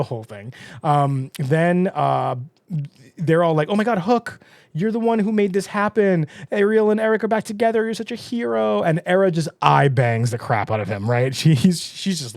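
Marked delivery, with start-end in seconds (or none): 3.7-8.8 higher, faster gushing praise. Retold or imitated. The group gushing over Hook as a hero, excited. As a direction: heap praise on him breathlessly.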